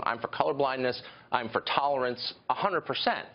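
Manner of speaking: usual speaking voice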